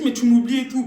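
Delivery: loud, reproachful cry; annoyed cousin shouting that she was forgotten; cousin's reproach. Retold or imitated. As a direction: hit her with the blame. Cousin angry at being forgotten.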